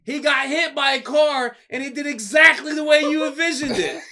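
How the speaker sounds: high, loud, breathless report; excited retelling. Retold or imitated. Coworkers telling him the crash happened, high loud excitement. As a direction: rush the story out, thrilled.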